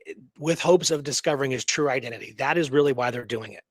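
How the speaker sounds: ordinary talk, nothing marked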